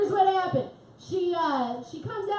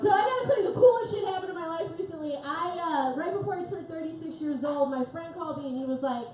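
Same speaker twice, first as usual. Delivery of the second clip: ordinary delivery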